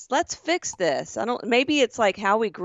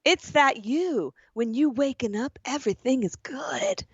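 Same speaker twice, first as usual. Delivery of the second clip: slow, fading to a whisper at the end; lightly put-on voice going breathy; character voice for the texter; 3 semitones higher than usual. Voicing the stranger's texts for her cohost, slow and fading to a whisper. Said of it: "You're giving her a voice".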